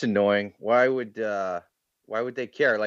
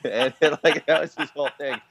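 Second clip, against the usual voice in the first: ordinary talk, nothing marked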